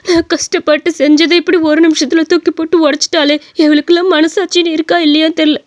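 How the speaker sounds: acted weeping lament; crying. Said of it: "எதுக்கு இப்படி அழுதுகிட்டு இருக்க?". (அழுதுகொண்டே புலம்புதல்)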